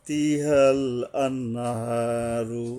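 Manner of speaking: আন্নাহারু drawn out very slowly; slow recitation. Shown as a demonstration. Teacher shows the long vowel, very slow chant. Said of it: "লম্বা হা আসলে grammar মানতে হবে".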